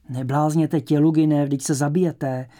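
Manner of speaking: loud, urgent protest held in pitch; muted cry of alarm. A narrator's reading. Ivan tries to stop Tělugin eating the toadstool, muted alarm. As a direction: cry out urgently without a full scream. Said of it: "vykřikl tlumeně Ivan".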